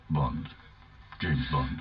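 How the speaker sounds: low, suave spy voice; deep, smooth secret agent; James Bond. Acted. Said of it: "this is one I did earlier".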